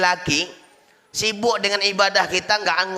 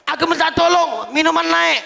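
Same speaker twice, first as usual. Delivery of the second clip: protesting plea; 10 semitones higher, 4 dB louder than usual. Acted. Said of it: "Rakyat rame-rame demo sama Allah".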